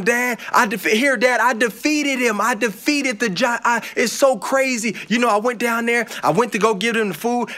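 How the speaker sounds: put-on high, eager boyish bragging; a kid bursting to tell his dad; excited boy. David brags to his father about the giant, excited and high.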